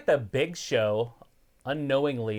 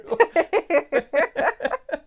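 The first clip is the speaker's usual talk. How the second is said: high laugh; 6 dB louder than usual. Real, not acted. Laughing at own joke.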